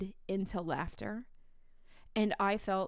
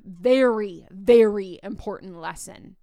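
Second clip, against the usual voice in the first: both "Very" and "very" punched much louder; loud, weighty emphasis on each "very"; emphatic stress; 10 dB louder than usual. Real, not acted. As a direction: hit "Very, very" hard, with a beat between. Stresses the lesson learned.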